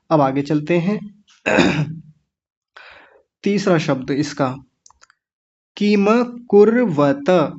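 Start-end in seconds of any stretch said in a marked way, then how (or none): 5.8-7.5 किम कुर्वत slow, full म and full त; correct recitation. Shown as a demonstration. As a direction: give किम its final a. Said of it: "किम नहीं बोलना".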